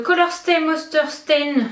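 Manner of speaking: slow, careful attempt at an English accent on a product name; sounding out the English brand name in a shaky English accent. Real, not acted. Vlogger reading a product name to her viewers, in a self-admittedly bad English accent. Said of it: "mon accent anglais est terrible".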